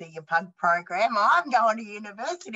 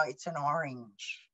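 flat, quiet and bored, like rolling the eyes; a teen who can't be bothered answering; 6 semitones lower, 8 dB quieter, slower than usual. Retold or imitated. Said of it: "roll my eyes and go".

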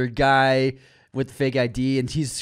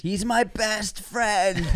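slow, high-pitched emotional declaration; hammed-up drawn-out cry of loyalty; mock heroic devotion; 6 semitones higher, 3 dB quieter, much slower than usual. Friend rescuing his passed-out pal, mock heroic.

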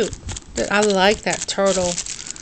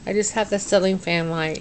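ordinary talk

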